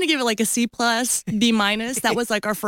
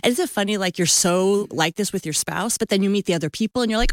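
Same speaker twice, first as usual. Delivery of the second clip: ordinary delivery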